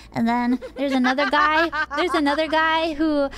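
normal talking voice

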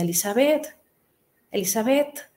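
remembered mother's voice calling her daughter at night, mildly imitated. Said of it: "yo escuchaba la voz de mi mamá".

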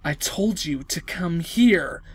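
low, slow threatening madman; a cold command from the killer; menacing villain. Acted. Villain voice chasing the girl.

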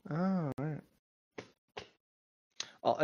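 ordinary talk, nothing marked

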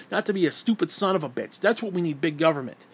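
normal talking voice

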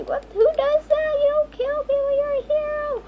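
high-pitched outraged telling-off; squeaky moral outrage; indignant scolding. Real, not acted. Scolding a killer player.

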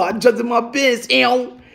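usual speaking voice

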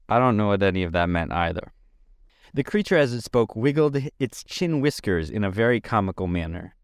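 plain reading voice

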